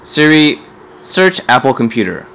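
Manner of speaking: ordinary delivery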